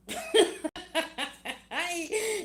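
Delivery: real high burst of laughter. Real, not acted. Reader laughing at enemies' failed plan, high laugh.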